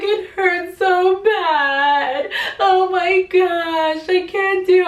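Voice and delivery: high, slow crying plea, voice breaking; re-living the sob of labor pain, wailing; retold crying. Mother in labor tells her family it hurts, crying. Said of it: "I was legit crying, tears and everything".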